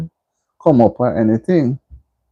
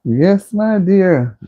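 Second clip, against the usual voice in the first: drawn-out greeting; 6 semitones higher, much slower than usual. Real, not acted.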